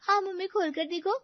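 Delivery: put-on high, bright young girl urging; eager daughter. Daughter urging mother to open the surprise, high eager voice. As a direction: urge mom to open gift.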